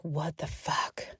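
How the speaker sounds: slow frightened whisper; hushed alarmed swear; scared whisper. Retold or imitated. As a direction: breathe it, spooked and slow. Retelling early fright.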